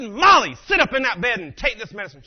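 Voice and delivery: loud, high, fed-up order; stern dad shouting. Retold or imitated. Dad ordering daughter to sit up, angry shout. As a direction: burst out on the name, then order.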